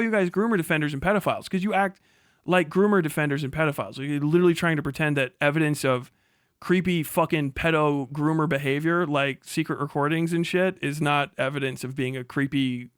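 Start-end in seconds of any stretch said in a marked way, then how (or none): none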